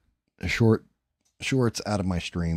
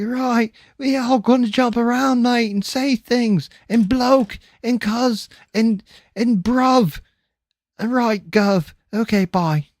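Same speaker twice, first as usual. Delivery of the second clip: very high hammed-up British cockney; squeaky over-the-top London geezer voice; mock British accent; 8 dB louder than usual. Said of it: "Over in Britain, it's just like".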